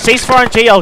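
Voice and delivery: high, loud cease-fire call; shouted order. Real, not acted. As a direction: call it out over the gunfire. Stopping fire for the grenade launcher.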